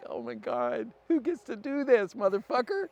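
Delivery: high-pitched retold astonishment; incredulous wonder, soaring high; amazed disbelief. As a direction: let it climb into a loud, high marvel. Retelling his amazed reaction.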